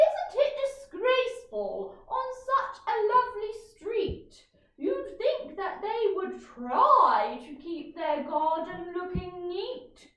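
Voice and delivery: put-on high, loud, huffy old lady; indignant old neighbour. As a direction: complain like a scandalised old neighbour.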